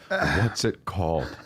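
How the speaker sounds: very slow, low and calm; really calm. Retold or imitated. Client asking agent about the documentary, really calm. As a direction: ask it calmly, drawing the words out. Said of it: "really calmly, I said".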